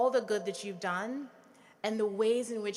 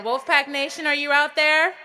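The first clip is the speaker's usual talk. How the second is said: even louder rising shout to the crowd; louder crowd call; 5 semitones higher, 11 dB louder than usual. Real, not acted. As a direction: call out bigger, rising at the end. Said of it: "it doesn't sound like you're out there".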